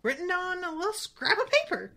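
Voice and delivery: high, blithe mock knight; unbothered character voice, lifting at the ends; Sir Cadogan. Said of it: "he's like".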